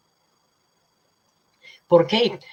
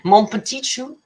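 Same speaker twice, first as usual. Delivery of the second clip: playful French; 7 semitones higher, 7 dB louder than usual. Real, not acted. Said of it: "I only know the bad words".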